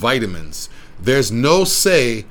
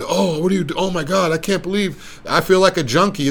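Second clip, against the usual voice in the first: mock-panicked client, higher pitched; paranoid client; 6 semitones higher than usual. Trainer mimicking a paranoid client afraid of injections, higher mock panic. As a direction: imitate a nervous first-timer freaking out. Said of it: "I've had a lot of people paranoid".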